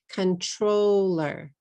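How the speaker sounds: slow, drawn-out "Controller" in three English syllables. Shown as a demonstration. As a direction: say "Controller" slowly so each syllable is heard. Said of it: "How many syllables do you hear?".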